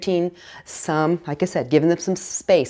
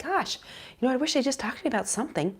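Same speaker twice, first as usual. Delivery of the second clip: wistful wish; 6 semitones higher, 9 dB quieter, faster than usual. Retold or imitated. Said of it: "and you're like".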